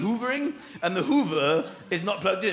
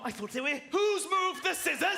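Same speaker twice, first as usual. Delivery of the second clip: higher-pitched indignant demand; mock outrage; 7 semitones higher than usual. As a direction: demand answers, rising in exasperation. Acted outraged hunt for scissors.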